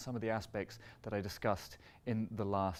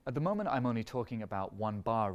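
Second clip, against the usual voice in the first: usual speaking voice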